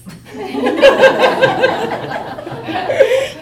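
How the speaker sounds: high burst of real laughter; cracking up hard; loud real laugh. Real, not acted. Speaker recalls her son's comeback, bursting into real laughter. Son scores a point.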